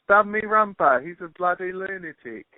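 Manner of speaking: high-pitched Aussie attempt slipping into British; botched Australian accent. Shown as a demonstration. Guest attempts Aussie for host, botched high-pitched Australian accent. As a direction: overdo an Australian accent till it sounds British. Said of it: "You just did it to David Beckham".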